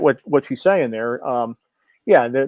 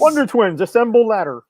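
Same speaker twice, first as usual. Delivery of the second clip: higher, hammed-up hero cry; mock superhero call; 7 semitones higher than usual. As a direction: chant it like a cartoon hero. Chanting a cartoon catchphrase.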